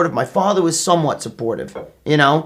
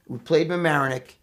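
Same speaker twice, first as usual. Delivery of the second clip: normal talking voice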